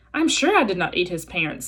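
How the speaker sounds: plain reading voice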